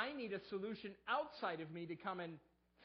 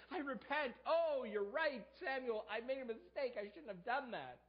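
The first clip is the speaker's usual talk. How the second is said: mock repentance; 6 semitones higher, 3 dB louder than usual. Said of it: "He doesn't say".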